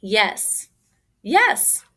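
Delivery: usual speaking voice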